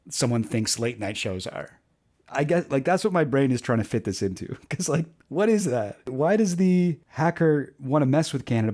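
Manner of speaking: usual speaking voice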